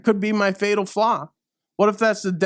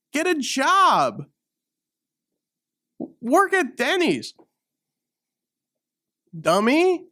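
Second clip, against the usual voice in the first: taunting; 6 semitones higher than usual. Acted. Taunting the critics.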